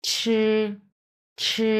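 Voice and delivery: Mandarin first tone on ছি. Shown as a demonstration. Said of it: "এক নম্বর tone".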